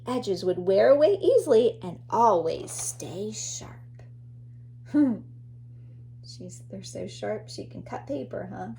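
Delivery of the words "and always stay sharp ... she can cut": put-on a secretive whisper to a child. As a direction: share it like a secret. Reading animal facts to child.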